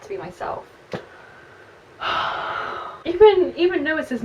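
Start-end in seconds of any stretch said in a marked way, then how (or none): 2.0-3.0 weary sigh. Real, not acted. Weary reaction to book scene.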